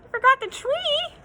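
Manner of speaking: high, stunned question; can't believe it; shocked echo. Acted. Moose stunned to hear he forgot the tree.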